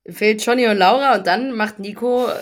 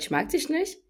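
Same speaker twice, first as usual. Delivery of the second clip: higher, softer put-on quote; offhand mimicry, pitched up; mock blurted confession; 9 semitones higher, 5 dB quieter than usual. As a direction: lightly imitate a random blurted remark. Acted blunt quote of contestant.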